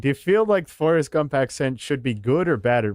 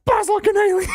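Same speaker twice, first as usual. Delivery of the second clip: laughing squeal. Real, not acted. Laughing fit at funny line.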